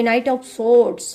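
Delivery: Knight of Swords drawn out very slowly; slow, dramatic unveiling of the card name; slow reveal. Real, not acted.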